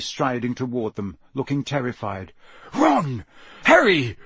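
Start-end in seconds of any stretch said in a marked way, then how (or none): 2.7-4.2 high, anxious calling; shaken calling. A narrator's reading. Father checks on the fallen children, shaken calling. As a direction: call each name in alarm. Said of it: "His voice sounded shaky".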